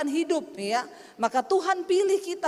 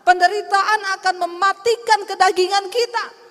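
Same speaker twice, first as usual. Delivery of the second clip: loud, driving sermon exhortation; preaching at full force; 3 semitones higher, 7 dB louder than usual. Acted. Preacher urges the congregation about suffering, loud and forceful. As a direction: hammer each line out to the crowd.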